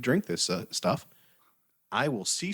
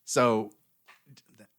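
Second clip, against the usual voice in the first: ordinary delivery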